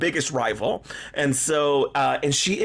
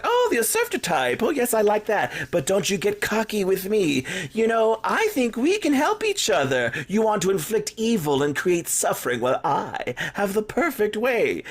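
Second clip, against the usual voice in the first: higher, purring villain voice, amused and scheming; villainous witch; 6 semitones higher than usual. Retold or imitated. Witch sweet-talking Crack Connie into an evil partnership, higher and purring. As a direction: play a witch savoring a new evil ally. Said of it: "She's like".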